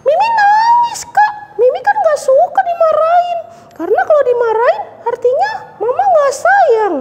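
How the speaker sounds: put-on high, whiny child voice; puppet girl Mimi. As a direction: complain like a little girl. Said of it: "Mimi bilang apa".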